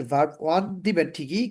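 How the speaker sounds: ordinary talk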